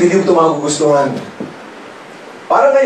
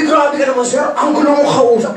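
high, whiny scolding; sponsor scolding; 8 semitones higher than usual. Retold or imitated. Sponsor whining back at the angry manager. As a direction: whine it back at him.

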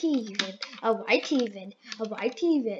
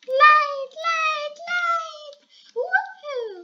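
excited high calls; 13 semitones higher, 5 dB louder, much slower than usual. Real, not acted. Calling for the light.

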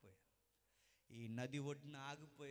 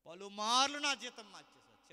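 indignant outburst; 8 semitones higher, 20 dB louder than usual. Acted. Jacob's complaint acted out.

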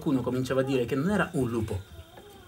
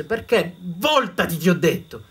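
louder, higher stern order; stern command; 5 semitones higher, 7 dB louder, slower than usual. Acted. Grandfather ordering the boy to turn away, stern.

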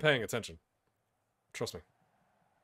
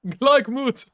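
loud call; 10 semitones higher, 8 dB louder than usual. Real, not acted. Calls the new word loud.

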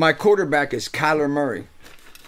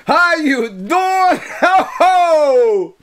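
boisterous greeting; 12 semitones higher, 9 dB louder, much slower than usual. Retold or imitated. Greeting someone with big laugh.

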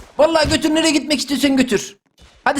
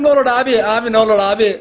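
begging in a lower, pitiful voice; hammed-up begging "ne olur abi"; mock pleading; 5 semitones lower than usual. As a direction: plead with him not to be dropped, half-joking. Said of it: "Tamam be, yalvarma".

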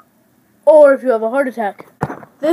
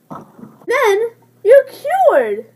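high, slow, hammed-up sob; mock sobbing; 7 semitones higher, much slower than usual. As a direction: wail it like a fake cry. Fake cure, crying.

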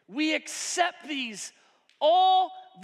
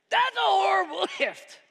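laughing outrage; 7 semitones higher, 5 dB louder than usual. Acted. Outraged at the gift.

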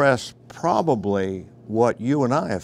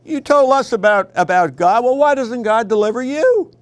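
high, loud taunting jeer; mocking crowd; 10 semitones higher, 6 dB louder than usual. Crowd taunting suffering David, loud mocking jeer. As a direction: sneer it up at David like a mocker. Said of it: "That, that's the mockery".

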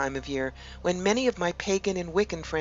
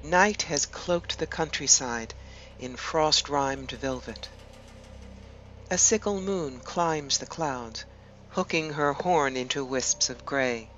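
measured meditation voice with long pauses between phrases; guided meditation; 6 dB quieter than usual. Acted. Host guiding listeners to relax and imagine an ancient wood, guided meditation. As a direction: lead them gently inward, leaving space after each phrase.

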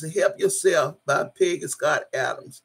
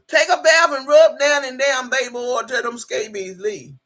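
higher and louder, laying down the law; emphatic rant; 6 semitones higher, 5 dB louder than usual. Real, not acted. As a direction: scold her into the bath. Ranting advice about scabies.

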